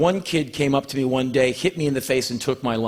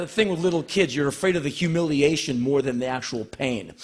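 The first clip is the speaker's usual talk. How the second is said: usual speaking voice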